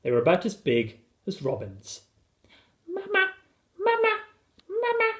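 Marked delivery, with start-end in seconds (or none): 2.9-5.1 put-on tiny high-pitched little boy; very high, piping calls; chirruping child. A narrator's reading. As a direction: chirp it like a baby bird. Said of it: "chirruped Philip".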